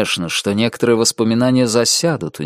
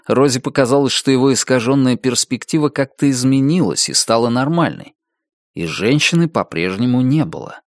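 plain reading voice

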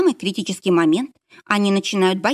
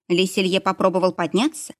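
plain reading voice

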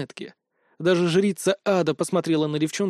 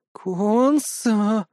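drawn-out, higher languorous call; languid; 4 semitones higher than usual. A narrator's reading. Nastya reaches for the hero, languid and seductive. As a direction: stretch the name out seductively. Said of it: "томно прошептала Настя".

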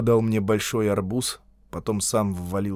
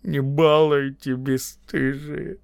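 slow, drawn-out, higher-pitched mumble; mumbling; 4 semitones higher, slower than usual. A narrator's reading. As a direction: mumble the reproach, dragging each word. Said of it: "а Пензенская промямлила".

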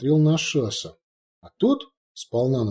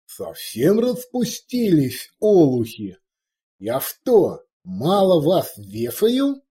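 slow, louder, higher furious dressing-down; angry scolding; 4 semitones higher, 3 dB louder, slower than usual. Acted. Orc chief berates his cowering warrior, furious. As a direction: hammer each word at the underling.

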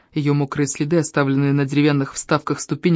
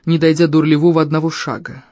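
ordinary delivery

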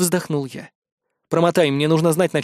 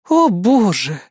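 horrified; 3 semitones higher, 3 dB louder, much slower than usual. Acted. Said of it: "ужаснулась помощница".

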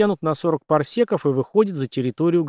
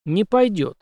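firm, raised-pitch refusal; resolute refusal; 7 semitones higher, slower than usual. A narrator's reading. Major rejecting Henry's proposal, resolute. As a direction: turn it down flatly. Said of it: "решительно отказался майор".

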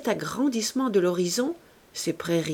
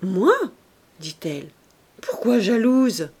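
surprised; 5 dB louder than usual. A narrator's reading. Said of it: "Elle parut toute surprise".

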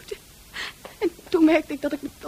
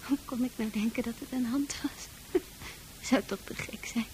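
hushed, breathless dread; frightened whisper; 4 semitones lower, 11 dB quieter than usual. Acted. Francis whispering in terror at a claw on the wall. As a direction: whisper as if it might hear you.

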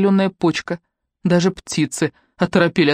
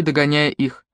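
ordinary delivery, nothing marked

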